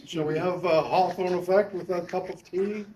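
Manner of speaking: put-on a playful British accent over tea; teasing in a put-on British accent; mock British accent. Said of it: "That's how you say it if you're a Brit".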